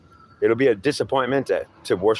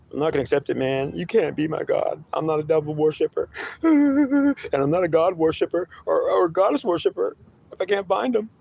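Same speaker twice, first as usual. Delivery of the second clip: high whiny seeker, half laughing; 7 semitones higher than usual. Acted. Seeker refusing his God, high and whiny with a laugh.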